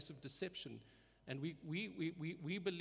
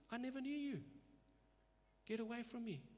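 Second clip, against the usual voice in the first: much higher, voice of the Lord turning them away; the Lord's rebuke; 8 semitones higher, 4 dB quieter than usual. Retold or imitated. The Lord rejecting false believers, in a higher judging voice. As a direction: pronounce it as a final judgement. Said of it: "And the Lord says".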